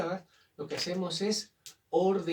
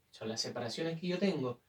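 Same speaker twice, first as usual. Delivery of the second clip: ordinary talk, nothing marked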